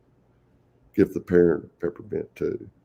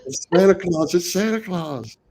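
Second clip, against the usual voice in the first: put-on much higher excited kid's voice; excited child; 11 semitones higher than usual. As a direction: squeal it like a kid spotting Santa. Excited kids spotting Santa.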